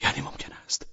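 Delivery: a hushed, breathless whisper. Acted. Man repeating his shameful thought, whispering. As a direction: say it again under your breath.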